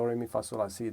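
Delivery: usual speaking voice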